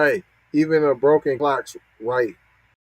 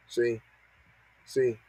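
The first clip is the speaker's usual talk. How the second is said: ordinary delivery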